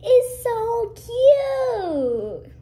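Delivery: high, drawn-out gush. Real, not acted. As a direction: stretch it out in delight. Gushing over nail.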